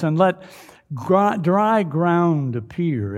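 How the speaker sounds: loud, high, proclaiming scripture reading; booming biblical narration. Acted. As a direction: boom it out like a preacher.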